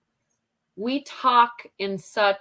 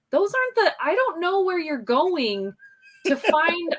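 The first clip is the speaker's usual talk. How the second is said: high-pitched mock bewilderment; exaggerated, high incredulous protest; retold bafflement; 9 semitones higher than usual. Retold baffled reply to family.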